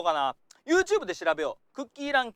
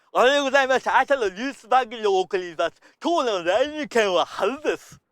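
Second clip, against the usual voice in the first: louder mock anchor with sloppy, slurred words; mumbling newsreader; 6 dB louder than usual. Acting coach mocks a newsreader with bad diction, slurred and louder. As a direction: play a news anchor who can't articulate. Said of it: "ニュース番組とかでもね".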